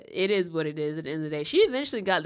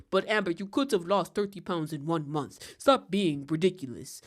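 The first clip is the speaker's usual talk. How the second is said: a light attempt at Doctor Now's accent; lightly put-on doctor accent, scolding; mock scolding in a rough Doctor Now accent. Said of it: "I can't do my Doctor Now accent".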